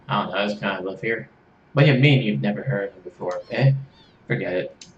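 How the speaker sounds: normal talking voice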